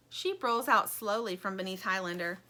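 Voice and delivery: plain reading voice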